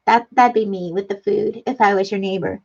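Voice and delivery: normal talking voice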